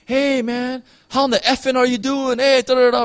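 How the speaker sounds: high, loud, casual jock greeting; loud buddy. Retold or imitated. Teammate at sports hailing the pastor, high and loud. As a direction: call out like a rowdy teammate.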